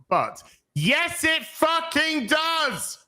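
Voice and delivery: slow, high, drawn-out stress on "fucking does"; emphatic. Real, not acted. Ranting at studio staff.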